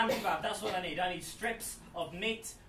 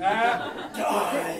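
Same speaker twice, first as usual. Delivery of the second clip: demon voice; 7 dB louder than usual. Pretend. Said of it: "say it in a demon voice".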